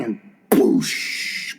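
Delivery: breathy blast noise; whispered boosh. Retold or imitated. Imitating the gun, a breathy boosh. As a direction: puff out the explosion.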